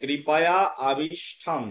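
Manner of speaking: কৃপায়া আবিষ্ঠম sounded out slowly; slow, careful model of কৃপায়া আবিষ্ঠম; demonstrated pronunciation. Shown as a demonstration. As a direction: draw out কৃপায়া আবিষ্ঠম for learners to copy. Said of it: "এর উচ্চারণ হবে".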